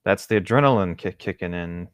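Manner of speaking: normal talking voice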